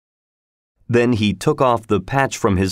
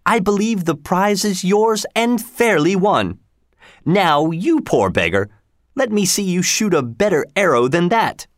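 higher, haughty sheriff voice; a proud lord sneering at a beggar; pompous sheriff; 8 semitones higher than usual. Acted. Sheriff mocking the ragged stranger, haughty and high.

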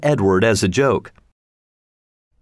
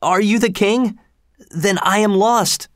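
put-on higher, dismayed young voice; a boy realising he is lost; frightened cry; 9 semitones higher than usual. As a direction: Tom crying out on his knees before the king.

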